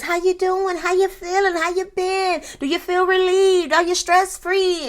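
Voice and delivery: high-pitched, exaggerated small talk; mock pleasantries. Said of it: "they start off with the pleasantries".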